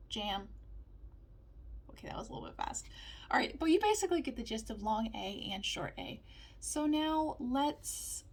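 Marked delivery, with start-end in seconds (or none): 1.9-2.9 softly whispered aside. Real, not acted. Aside after fast drill. 6.6-8.2 very slow, stretched words; drawn out. Acted. Building up to the mix.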